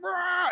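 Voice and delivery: high imitated monster roar; a playful killer's growl; mock roar. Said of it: "he's like".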